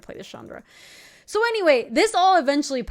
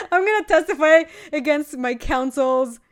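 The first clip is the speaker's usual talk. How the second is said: laughing; 5 semitones higher, 7 dB louder than usual. Real, not acted. Laughing while confessing.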